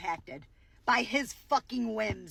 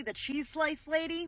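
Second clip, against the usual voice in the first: usual speaking voice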